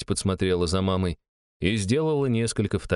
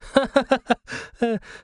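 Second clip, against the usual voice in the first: high giggling laugh; amused laugh. A narrator's reading. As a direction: laugh fondly at a cute kid. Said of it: "отсмеялась Шестакова".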